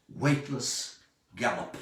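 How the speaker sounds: ordinary delivery, nothing marked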